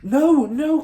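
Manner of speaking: teacher denying the accusation; crying out. Acted. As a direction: cry out in denial. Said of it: "cried Miss Honey".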